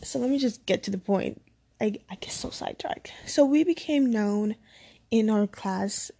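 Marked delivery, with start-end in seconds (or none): 1.8-3.1 hushed, unvoiced confession of rambling; whispered aside. Real, not acted. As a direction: whisper it as a quick aside to yourself. Aside while getting to point.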